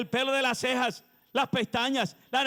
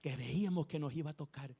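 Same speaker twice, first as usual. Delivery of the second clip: low, much softer murmur of mild disappointment; lepers muttering to each other; 5 semitones lower, 8 dB quieter than usual. Retold or imitated. Lepers nudging each other, disappointed, hushed grumbling. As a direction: nudge each other and grumble under your breath. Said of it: "se miraban y decían".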